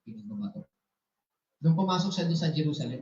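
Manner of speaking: usual speaking voice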